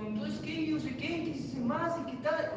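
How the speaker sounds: high, lightly mocking gossip; mock their empty small talk; vain chatter. Preacher mimics church youth chatting idly, high and mocking.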